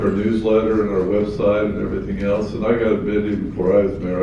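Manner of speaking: ordinary talk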